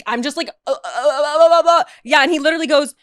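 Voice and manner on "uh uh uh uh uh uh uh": mock stuck stammer on "uh"; speechless stammer. Starstruck guest facing a famous man, speechless stammer. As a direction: babble helplessly, rising at the end. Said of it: "I literally can't speak. I'm just like".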